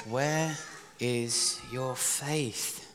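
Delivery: very slow, measured words with pauses; slow, grave question. Acted. Jesus questioning his disciples after the storm, slow and deliberate. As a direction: let each word hang.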